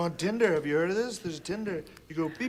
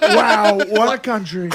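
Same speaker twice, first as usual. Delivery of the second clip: punchy Trump voice, louder; Trump impression; 3 semitones higher, 9 dB louder than usual. Comedian does Trump for the panel, loud and punchy. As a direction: hammer it like Trump at a rally. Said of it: "You got a good Trump".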